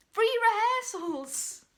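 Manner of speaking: slow, high, loud exclamation; indignant outburst. Acted. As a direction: cap the list with loud outrage. Indignant climax of investment list.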